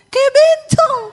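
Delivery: very high, loud mock scream; comic outburst of horror; horrified yell. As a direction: shriek it like the appalled husband. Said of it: "dia teriak".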